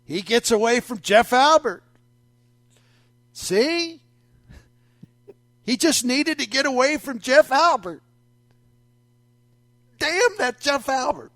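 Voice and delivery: high, know-it-all mock voice; mocking listeners. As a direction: play the smug fan who's sure. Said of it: "You're saying".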